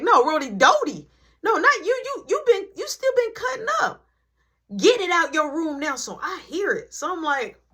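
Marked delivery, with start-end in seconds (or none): 0.0-5.9 high, loud furious mom shout; angry mom yelling. Retold or imitated. Mom shouts at her son for taking his stuff, furious. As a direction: blast him like an outraged mother.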